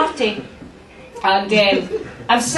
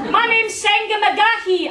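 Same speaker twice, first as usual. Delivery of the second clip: local news reporter; 9 semitones higher than usual. Retold or imitated. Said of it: "You know that woman".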